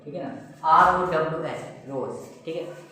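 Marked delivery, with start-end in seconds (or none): 0.7-1.7 slow letter by letter; teacher spells R O W S slowly to the students; spelling it out. Shown as a demonstration. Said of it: "इनको कहते हैं रोज".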